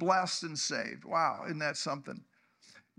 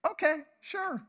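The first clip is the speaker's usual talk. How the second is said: much higher, casual, cheerful yes; easy-going God; 9 semitones higher than usual. Acted. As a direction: answer like a generous father. God voiced answering kindly.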